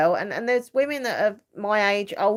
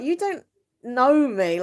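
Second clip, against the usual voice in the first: annoyed retort; 6 semitones higher, 3 dB louder, slower than usual. Retold or imitated. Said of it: "it really annoys me".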